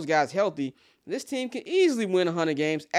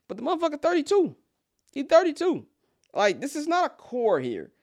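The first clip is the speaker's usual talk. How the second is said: much higher, fast, disbelieving; incredulous scoff; 11 semitones higher than usual. Real, not acted. Scoffing at Patrick Wisdom being thirty-two, incredulous high voice. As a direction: squeak out the age in mock disbelief.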